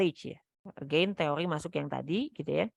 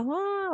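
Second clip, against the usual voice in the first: mock tantrum yell, much higher; imitated toddler scream. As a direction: play the child shrieking mid-tantrum. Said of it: "Nanti dia teriak lagi". (meniru teriakan anak tantrum)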